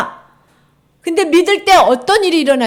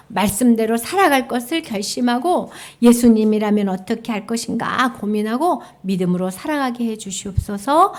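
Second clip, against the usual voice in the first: ordinary delivery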